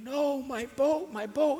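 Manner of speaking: high, loud, slow wail; crying child. A narrator's reading. Boy crying after his lost boat, slow high wail. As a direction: cry out as the boat floats away. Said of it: "Jeffrey started crying".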